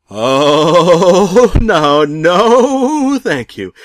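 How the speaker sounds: high, loud laughing no; laughing refusal. Acted. Narrator refusing the pizza man, laughing loudly.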